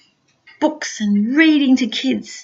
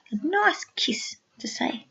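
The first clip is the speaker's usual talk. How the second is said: soft bedtime whisper; 8 dB quieter than usual. Real, not acted. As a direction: hush it as lights go out. Hushed bedtime aside.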